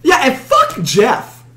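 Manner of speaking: slow, high put-on voice; hammed-up drawl. Joining the running joke about Jeff, slow goofy voice. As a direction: stretch it out in a goofy voice.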